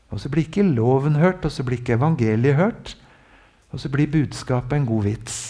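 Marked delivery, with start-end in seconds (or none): none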